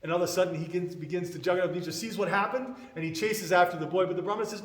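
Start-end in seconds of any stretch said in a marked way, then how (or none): none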